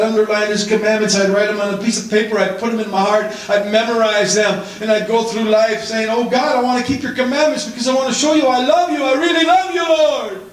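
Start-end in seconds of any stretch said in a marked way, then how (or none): 6.1-10.4 high, fast earnest prayer. Retold or imitated. Young convert telling God he loves him, high and eager. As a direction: pour out devotion eagerly.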